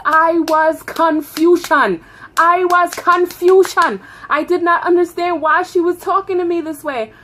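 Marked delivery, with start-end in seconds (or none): 0.1-4.0 high, loud, drawn-out declaration; comic confusion. Acted. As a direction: slowly proclaim it, hammed-up bafflement. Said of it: "I was just so confused".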